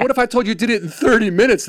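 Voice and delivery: high-pitched mock sales hype; imitating a TV pitchman. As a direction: raise the stakes like an infomercial host. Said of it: "pitch guy".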